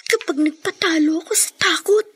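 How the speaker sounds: soft unvoiced whisper of despair. Girl alone after the threat, whispering. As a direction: breathe it out, barely audible.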